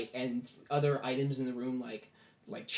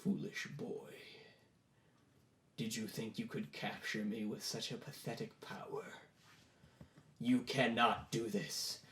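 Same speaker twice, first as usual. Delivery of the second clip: hushed, lower demon voice; demon's voice; 4 semitones lower, 10 dB quieter than usual. Acted. Summoned demon scorning the boy, hushed low voice. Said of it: "loud voice that suddenly booms out".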